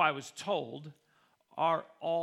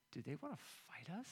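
hushed alarm; 2 semitones lower, 18 dB quieter, faster than usual. Retold or imitated. Panicked thought as team charges.

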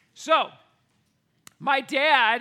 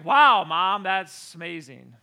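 sarcasm; 4 semitones lower, 8 dB louder, slower than usual. Retold or imitated. Sarcastic reply to mom.

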